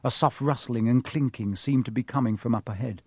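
ordinary delivery

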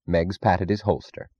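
plain reading voice

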